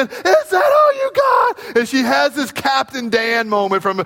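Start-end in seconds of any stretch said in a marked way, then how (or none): 0.0-1.8 very high shrieking dare, half crying; crying defiant shout. Retold or imitated. Small daughter jumping in waves, shrieking a dare. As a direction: scream the challenge through tears. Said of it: "and she's jumping".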